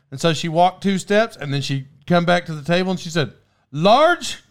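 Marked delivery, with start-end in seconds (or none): 3.7-4.4 mock waitress. Waitress checking order again.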